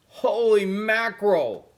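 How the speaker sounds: loud, high, slow astonished cry; the cat startled by the snapping shade; surprised. Acted. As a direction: blurt it out in shock.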